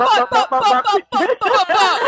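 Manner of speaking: high, playful imitation of what people say; high mock bop bop chant; mock Jamaican bop. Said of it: "the first thing they do is go".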